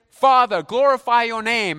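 acted prayerful cry; calling to God. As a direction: call it out high and loud to the heavens. Acts Jesus calling to the Father.

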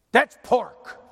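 loud, scandalized objection; indignant shout. Retold or imitated. Church member at the picnic scolding the preacher, indignant shout. Guy objects to eating pork.

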